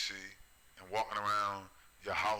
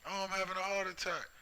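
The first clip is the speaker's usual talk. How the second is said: much higher, hammed-up panic; mock heart attack; 10 semitones higher than usual. Mocking a frightened unbeliever clutching his heart, high hammed-up whine. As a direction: whine like someone faking collapse. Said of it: "people gonna be running out the store freaking out".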